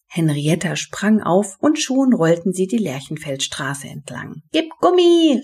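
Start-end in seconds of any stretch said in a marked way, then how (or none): 4.5-5.4 high, loud cheer-on; urging shout. A narrator's reading. Henrietta on the skateboard with Benny, shouting him on. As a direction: shout it high to spur someone on. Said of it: "rief Henrietta".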